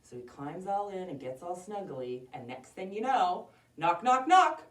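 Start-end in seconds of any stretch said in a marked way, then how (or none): 3.8-4.6 rising knock-knock, last one louder; imitated knocking. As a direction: punch out each knock, climbing higher.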